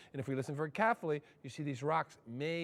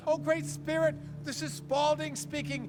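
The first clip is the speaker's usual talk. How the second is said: panicky prayer; 9 semitones higher than usual. Retold or imitated.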